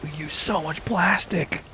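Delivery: high, slow exasperated complaint; high-pitched lament. Real, not acted. Lamenting all the plastic, high drawn-out voice. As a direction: draw it out high in dismay.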